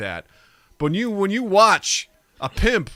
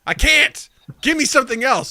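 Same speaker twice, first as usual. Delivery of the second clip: louder, much higher exasperated insistence; insist, pitched up and loud; firm clerk; 10 semitones higher, 6 dB louder than usual. Retold or imitated. Clerk telling a customer the card fails, exasperated and loud. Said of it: "I'm like".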